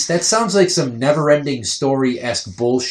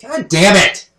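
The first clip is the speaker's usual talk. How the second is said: loud, higher-pitched burst of frustration; exasperated outburst; 8 semitones higher, 7 dB louder, slower than usual. Retold or imitated. Man retelling his frustration at a mishap, exasperated outburst. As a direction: reenact losing your temper.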